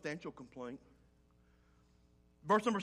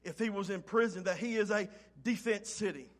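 rising, louder preacher's cadence, driving each phrase home; full-force preaching; 3 semitones higher, 8 dB louder than usual. Acted. Pastor urging the congregation through Jeremiah's trial, preaching loud. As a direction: preach it hard and build.

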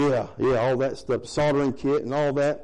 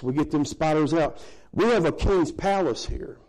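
ordinary talk, nothing marked

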